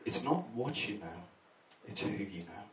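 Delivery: not what you know, it's WHO you know, softly; parent's saying, stressed. Retold or imitated. Comic quoting a parent's wise saying, stress on "who". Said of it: "I heard one parent say this".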